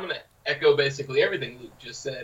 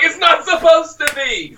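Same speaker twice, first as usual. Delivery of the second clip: defensive; 8 dB louder, slower than usual. Retold or imitated. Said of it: "I'm like".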